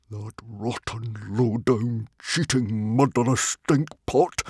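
slow, seething insults; through gritted teeth. A narrator's reading. Stoic cursing the cheat, seething through gritted teeth. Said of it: "from between gritted teeth".

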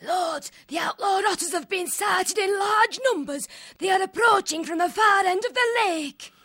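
urgent report shout. A narrator's reading. Soldier bursting in to report approaching otters, shouting. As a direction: shout the news at your lord.

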